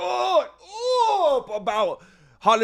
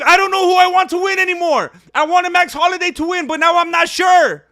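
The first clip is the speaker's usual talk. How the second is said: loud, high, near-shouting commentary with laughs; real fired-up yelling; excited shouting; 6 semitones higher, 7 dB louder than usual. Real, not acted. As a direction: caught up in the last minute, yelling at the screen. Final minute, torn over who wins.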